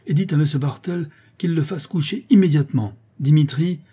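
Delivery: high, emphatic order; stern command. Acted. Countess sending her son to bed, stern. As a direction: order it with mounting severity.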